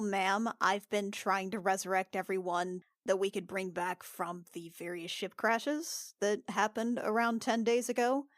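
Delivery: ordinary talk, nothing marked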